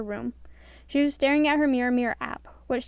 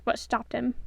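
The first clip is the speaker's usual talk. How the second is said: ordinary delivery, nothing marked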